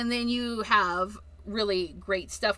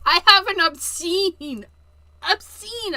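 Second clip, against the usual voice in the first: mock-dramatic confession; 7 semitones higher, 8 dB louder than usual. Real, not acted.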